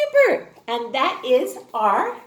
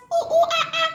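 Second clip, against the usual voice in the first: much higher playful monkey hooting; monkey calls; much faster than usual. Pretend. Teacher making puppet monkey call for kids, high hoots. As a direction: hoot like a cheeky little monkey.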